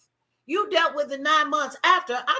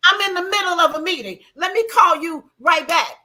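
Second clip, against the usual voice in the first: hurried excuse; 4 semitones higher, 7 dB louder than usual. Retold or imitated. Retold phone call, stalling.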